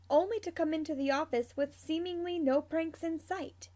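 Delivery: ordinary delivery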